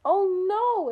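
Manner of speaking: Arnold, seeing the cake is done, cries out in loud alarm; alarmed exclamation. A narrator's reading. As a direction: blurt it out in alarm. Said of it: "exclaimed Arnold".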